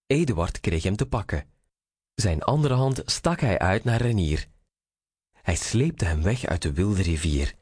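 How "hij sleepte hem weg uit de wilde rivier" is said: ordinary delivery, nothing marked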